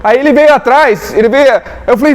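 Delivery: ordinary talk, nothing marked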